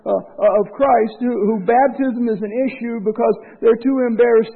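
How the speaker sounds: loud, rising preacher's voice; full-force preaching. Acted. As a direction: drive it home to the congregation.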